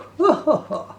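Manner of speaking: high chuckling laugh; hearty laugh. Acted. Percy laughing at his joke about the old well. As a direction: laugh at your own joke.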